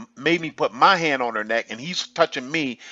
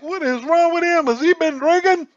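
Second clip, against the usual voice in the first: much higher, louder mocking voice of staring people; gawking onlookers; 14 semitones higher, 5 dB louder than usual. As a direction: play the nosy crowd wondering aloud. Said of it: "I didn't want nobody looking around like".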